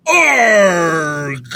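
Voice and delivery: loud mock pirate "Arg"; pirate growl. Trying out a pirate sound on the podcast, loud mock growl. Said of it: "Gotta work on my pirate shit".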